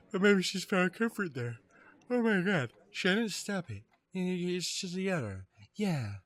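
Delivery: high-pitched, far from own voice; high-voiced game character.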